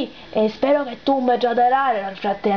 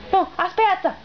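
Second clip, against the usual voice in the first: normal talking voice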